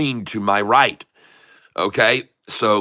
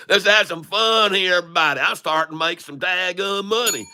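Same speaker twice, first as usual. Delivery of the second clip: put-on higher-pitched hollering country boy; 4 semitones higher than usual. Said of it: "switched over to the redneck".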